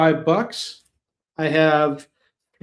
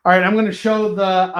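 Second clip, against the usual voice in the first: usual speaking voice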